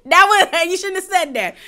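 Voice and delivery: high, scandalised disbelief; can't believe she said it; shocked. Real, not acted. Shocked reaction to Uba's remark.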